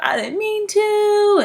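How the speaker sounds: put-on high, slow, whiny little-kid plea; imitating a child's teary high wail; crying child. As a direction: plead like a tearful toddler. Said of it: "the child's crying and freaking out".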